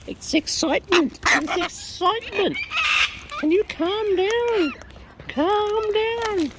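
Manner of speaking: high, squeaky comic voice; silly squeaky voice. Acted. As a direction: squeak like a cartoon birdie. Silly voice about excited birds.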